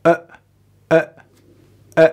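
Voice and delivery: short, relaxed "ا" as a neutral uh; isolated schwa. Shown as a demonstration. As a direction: let "ا" drop out as a brief schwa. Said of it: "ببینید هیچ فشاری روش نیست".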